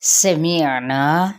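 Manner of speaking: سمعنا stretched very slowly, clear ain, na from the mouth and not the nose; slow tajweed demo. Shown as a demonstration. Teacher models سمعنا for students, very slow, long na without nasal sound. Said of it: "مد اصلی بالالف".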